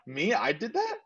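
retold can't-believe-it reaction; amazed disbelief. Retold disbelief at own success.

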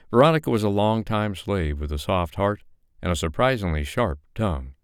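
ordinary delivery, nothing marked

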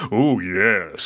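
lightly voiced villain, pitched well down; low, lightly acted villain's assent; hint of Megatron. Villain answering an underling's spy theory, lightly voiced and low. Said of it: "And Megatron goes".

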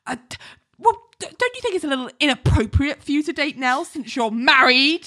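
mock-jealous objection, pitched high.

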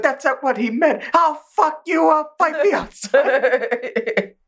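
hammed-up high-pitched threat; mock angry Inosuke. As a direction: challenge him in a squeaky bluster.

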